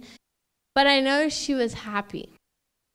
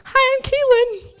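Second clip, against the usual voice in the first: much higher, awkward little hello to a stranger; awkward greeting; 14 semitones higher, 6 dB louder than usual. Retold or imitated. Daughter meeting mom's new partner, awkward high greeting. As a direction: chirp it high and unsure, caught off guard. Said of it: "like, kinda just there".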